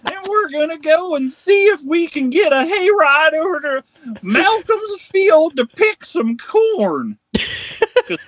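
high, slow, drawn-out West Virginia twang; West Virginia parody. Acted. Mothman announcing his trip to the creek, broad West Virginia accent. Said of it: "a West Virginia accent".

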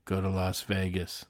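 low, soft divine command; voice from heaven. Acted. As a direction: intone it quietly, like heaven speaking. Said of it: "a voice from heaven".

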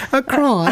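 cron as one syllable, laughing; amused cron for crayon; mimicked pronunciation. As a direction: say cron high, through a laugh. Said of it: "sounds like something a king would wear".

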